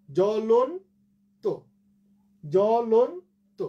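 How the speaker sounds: জ্বলন্ত drawn out in syllables, rising on lon; slow model pronunciation. Shown as a demonstration. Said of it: "উচ্চারণটা ঠিক করে নিও". (জ্বলন্ত টেনে ধীরে শুদ্ধ উচ্চারণ)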